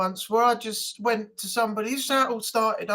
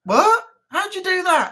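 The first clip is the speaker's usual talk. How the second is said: amazed; 9 semitones higher, 4 dB louder than usual. Retold or imitated. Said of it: "everyone was like".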